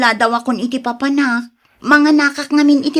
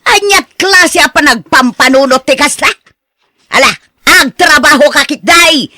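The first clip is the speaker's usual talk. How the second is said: loud scolding; 3 semitones higher, 7 dB louder than usual. Acted. Scolding to go work.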